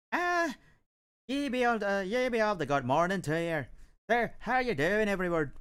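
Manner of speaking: high-pitched stage-Irish Dublin accent; classic Dublin accent. Shown as a demonstration. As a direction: put on a Hollywood-style Dublin brogue, pitched well up. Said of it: "there's the classic Dublin accent, of course".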